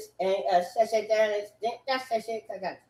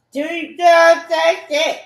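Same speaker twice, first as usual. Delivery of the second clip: shouted outburst; 7 semitones higher, 9 dB louder than usual. Real, not acted. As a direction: stretch each word into a loud yell. Sudden loud repeated outburst.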